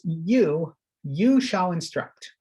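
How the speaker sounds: "You" said twice, then slow and weighted; slow, emphatic "you shall instruct"; stress on you. Shown as a demonstration. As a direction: hit "you" and slow the line down. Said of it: "there's this emphasis".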